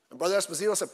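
ordinary talk, nothing marked

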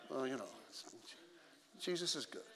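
very soft, low, reluctant mumble; tight-lipped. Acted. Believer suddenly shy talking about God, tight-lipped. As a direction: barely let the words out, lips held tight. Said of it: "all of a sudden we're tight-lipped".

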